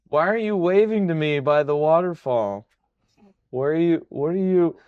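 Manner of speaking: hammed-up dazed prisoner; bewildered dreamer. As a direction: call out as if still half in a dream. Dreaming prisoner questions his friend.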